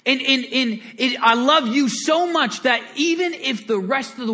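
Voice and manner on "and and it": usual speaking voice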